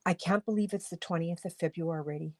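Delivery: ordinary talk, nothing marked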